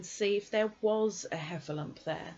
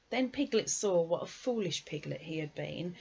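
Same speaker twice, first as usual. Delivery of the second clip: usual speaking voice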